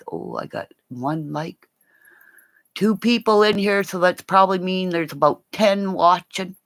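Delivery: usual speaking voice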